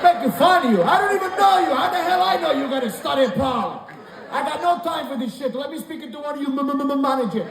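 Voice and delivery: mock outraged customer. Angry customer scene.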